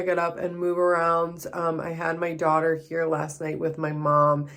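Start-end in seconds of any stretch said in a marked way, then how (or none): none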